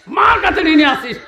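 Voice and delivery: sudden high-pitched outcry; shouted reproach. Real, not acted. Preacher reminding listeners of debt to mother, high shout. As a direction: leap high in pitch to drive it home.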